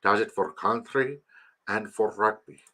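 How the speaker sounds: soft, lightly put-on South African accent; measured South African movie accent. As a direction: imitate Mandela with a South African lilt. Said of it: "He's from South Africa".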